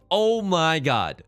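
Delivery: excited. Real, not acted. Thrilled at perfect hand.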